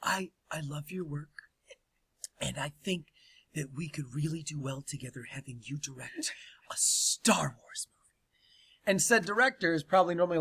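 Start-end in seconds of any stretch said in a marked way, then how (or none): 0.0-8.9 soft breathy whispered sweet talk. Retold or imitated. Kathleen whispering sweetly to the director. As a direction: whisper it sweetly, luring him in.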